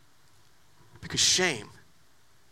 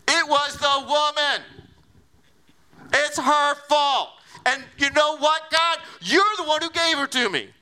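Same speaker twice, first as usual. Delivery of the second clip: Adam shifting blame; 5 semitones higher than usual. Acted. Adam blaming the woman and God, whining. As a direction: drag out the excuses like a sulky child.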